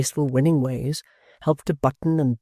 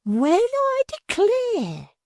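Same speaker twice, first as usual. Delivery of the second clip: shrill maid Miggs; much slower than usual. A narrator's reading.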